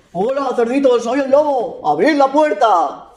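wolf demanding entry from the piglets; sly wolf. Acted. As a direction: drawl it like a big bad wolf. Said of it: "Y el lobo dijo".

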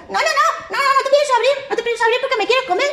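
squeaky stubborn no-no-no; defiant piglet. Acted. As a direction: piglet refusing the wolf. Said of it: "Y el cerdito le dijo".

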